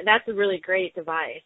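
normal talking voice

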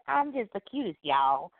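put-on high southern kid brag; Gravity Falls Gideon. Co-host imitates Gideon from Gravity Falls, high and cutesy. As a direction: preen like a cutesy Southern child. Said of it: "When he's like".

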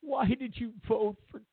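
mock-sob the question like a wounded pundit; whiny crying Van Jones. Comedian mocks TV host pleading with Trump voters, whining and crying. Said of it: "cries and whines like a little bitch".

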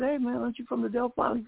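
high-pitched, re-enacted street greeting; excited hailing. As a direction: call out to someone you just recognized.

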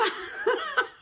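real high-pitched giggle; high laugh. Real, not acted. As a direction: squeal of laughter. Laugh alone on line.

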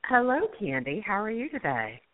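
ordinary talk, nothing marked

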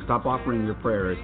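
usual speaking voice

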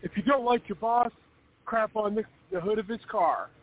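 high macho TikTok voice; mock macho bro. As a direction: ham up a tough guy telling you off. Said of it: "they're like".

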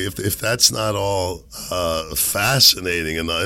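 normal talking voice

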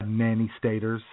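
ordinary talk

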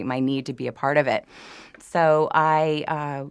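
normal talking voice

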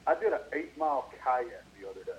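ordinary talk

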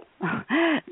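nervous laugh. Real, not acted. Laughs while describing dysfunction.

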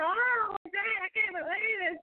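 high, mock girly disbelief; coy girl. Mocking a date feigning disbelief, high girly voice. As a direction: imitate a woman acting surprised it's happening. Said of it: "Sit there".

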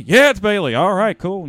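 loud, high, hammed-up enthusiasm; big mock-excited holler; excited re-enacted reply. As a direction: act out the happy household exchange. Re-enacted excited exchange at home.

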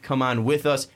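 ordinary delivery

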